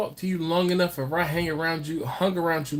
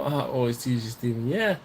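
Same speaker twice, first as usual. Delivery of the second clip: ordinary delivery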